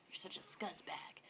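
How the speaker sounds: hushed whisper under the breath; barely voiced private jab; whispered insult. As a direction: whisper it after him so he can't hear. Muttered after the customer left.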